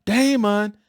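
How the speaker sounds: high, loud calling out across a store; calling a name. Retold or imitated. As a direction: call for the lost child. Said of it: "I can hear that".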